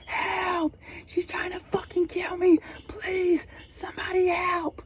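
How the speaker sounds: very high, desperate cry for help; screaming for help. Retold or imitated. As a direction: mimic a panicked scream for rescue. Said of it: "I'm screaming".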